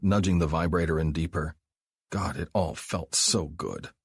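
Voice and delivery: usual speaking voice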